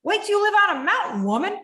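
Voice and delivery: high, fast, mocking taunt; inner critic voice. Voicing her own negative voice to the host, high fast mocking taunt. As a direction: sneer at yourself like a bully. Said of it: "she's like".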